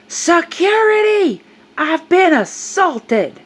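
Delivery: high, loud, drawn-out hammed-up cry; mock a melodramatic shout across a store; imitated yell for help. Shopper calling security on narrator, exaggerated mock yelling.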